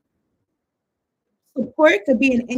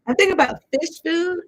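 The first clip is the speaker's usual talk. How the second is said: fish food lifted much higher; higher repeat; 7 semitones higher than usual. Real, not acted. Repeating her tarot hunch to viewers, much higher. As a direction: sing fish food up high.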